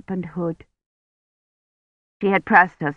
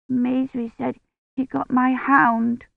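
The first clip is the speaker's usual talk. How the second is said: put-on slow, shaky child's voice; shivering; 6 semitones higher than usual. A narrator's reading. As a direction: speak while shivering with cold.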